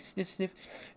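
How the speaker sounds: breathy mock sniff sniff, soft and unvoiced; whispered sniffing. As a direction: sniff it out under your breath like a hatchling. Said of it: "He's like".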